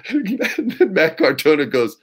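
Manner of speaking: usual speaking voice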